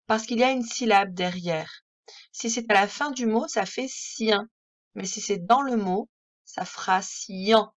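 ordinary talk

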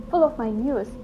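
usual speaking voice

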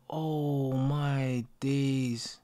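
usual speaking voice

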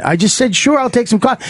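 acted-out indignant insistence; defensive repeat. Co-pilot repeats his coffee answer in a squabble, defensive.

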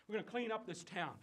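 ordinary talk, nothing marked

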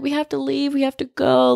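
imitating a tearful plea; mock crying plea. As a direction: plead as if retelling a teary panic. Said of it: "crying, like".

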